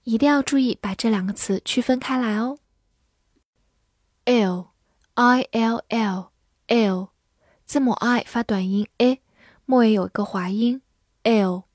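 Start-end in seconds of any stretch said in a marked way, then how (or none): 4.3-7.1 "Ill" drawn out, letters spelled slowly; slow spelling. Shown as a demonstration. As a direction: spell "I L L" deliberately for learners. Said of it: "spelling the word".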